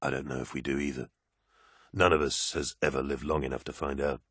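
low, teasing space marine; calm soldier, lightly amused; deep warrior voice. Acted. Loken's voice.

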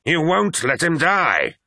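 slow, high-pitched furious snarl. A narrator's reading. First Captain with hands on apothecary's neck, snarled. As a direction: snarl it as a drawn-out threat. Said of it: "snarled Abaddon".

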